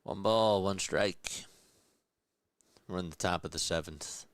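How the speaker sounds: live baseball announcer calling the pitch; play-by-play call. Acted. Streamer calling the game for chat, in announcer voice. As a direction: call it like a broadcaster in the booth. Calls the count.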